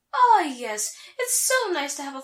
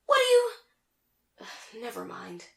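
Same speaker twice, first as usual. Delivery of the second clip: flustered, giving up; 4 dB quieter, much slower than usual. Acted. Naga teased about being a couple, flustered then resigned. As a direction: start indignant, then drop to a soft resigned murmur.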